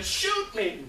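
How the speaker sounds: high, loud imitated yell. Man turning back on the group, yelling. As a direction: yell it out at a crowd. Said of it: "starts yelling at this group again".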